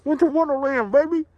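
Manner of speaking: loud, high cheer; excited shout. Real, not acted. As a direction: belt it out with joy. Cheering in the falling snow.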